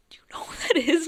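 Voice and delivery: whispered, voiceless and secret. Retold or imitated. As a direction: lean in and whisper it. Whispering on plane about him.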